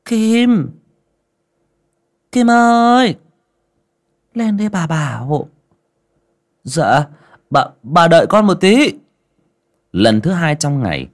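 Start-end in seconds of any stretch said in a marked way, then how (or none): 0.0-5.5 high, loud call across the house. A narrator's reading. Mistress calls Kim up to her room, high loud calling. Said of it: "mụ cất tiếng gọi". 6.6-9.0 high, loud eager reply; servant calling back. A narrator's reading. Kim answers the mistress from below, high loud call.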